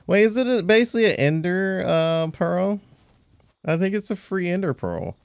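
high-pitched excited discovery; excited realization. Real, not acted. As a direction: lift the voice, thrilled.

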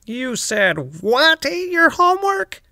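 a teacher who can't believe the excuse; incredulous teacher. Acted. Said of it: "asked Charlotte's teacher".

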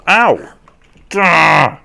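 high, loud "ow" then growing cry; pained yell. Real, not acted. Player hit while fleeing, yelling out. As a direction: cry out on being caught.

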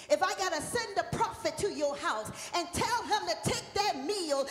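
loud, high sung preaching over music; full-force preaching. Acted. Pastor whooping to the congregation, loud sung preaching. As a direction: whoop it to the congregation, building to song.

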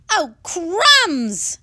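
loud high alarmed cry; a sudden fright. Acted. Cake's bad feeling.

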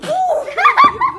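loud high shriek of laughter. Real, not acted. Shocked at amount of sauce.

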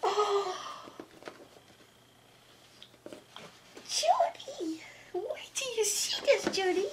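high, squealing delight with gasps; excited gasps. Real, not acted. Unboxing the puppy toy for the doll Judy, squealing with excitement. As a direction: gasp and squeal at the surprise.

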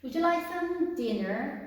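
very slow, every word laid out for learners; slow, careful classroom English; slow model reading. Shown as a demonstration. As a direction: read it slowly like a teacher modelling.

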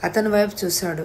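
ordinary delivery, nothing marked

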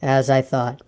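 low, slow, dejected donkey; gloomy Eeyore. Acted. Eeyore regards his reflection, low and gloomy. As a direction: drag the words out low and mournful.

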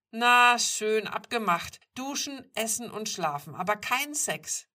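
loud, high, giving in; exasperated agreement. A narrator's reading. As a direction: concede and lay down firm terms. Gives in grudgingly, sets terms.

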